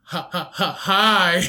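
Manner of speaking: awkward laugh then hi; mock pickup line. Mocking how his friend talks to girls. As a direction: play a nervous guy hitting on girls. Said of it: "You're like".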